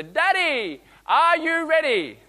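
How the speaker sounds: put-on high, loud child's call, slowed; a child calling from the pool edge; little girl calling. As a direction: call out like an eager four-year-old. Daughter asking again.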